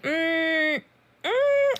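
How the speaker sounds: high, doubtful mock hum; ego hum. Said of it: "your ego is like".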